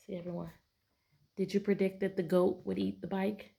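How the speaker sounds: ordinary delivery, nothing marked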